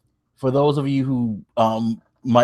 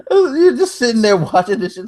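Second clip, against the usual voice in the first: high-pitched laugh through the words; 11 semitones higher, 3 dB louder than usual. Real, not acted. Fan laughing at a botched table spot. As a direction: squeal it out while laughing.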